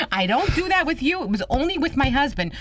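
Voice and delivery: high, retold prim protest; indignant refusal. Grandmother refusing man in her bed, indignant. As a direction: turn him down in mock indignation.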